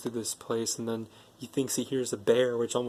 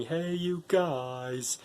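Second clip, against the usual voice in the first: slow, drawn-out high call; imitated calling out; 5 semitones higher, much slower than usual. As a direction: stretch each word like a holler from afar. Sloth calling out to the kids.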